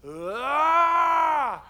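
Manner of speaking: sudden high shout of pain; mock patient yelling ah; loud pained yell. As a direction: let out a loud startled cry. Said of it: "he was like".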